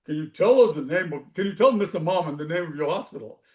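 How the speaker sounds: lightly put-on deep announcer tone, amused; rich deep radio voice. Host cutting in on the caller, in a mock deep radio voice. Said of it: "in his rich, deep radio voice".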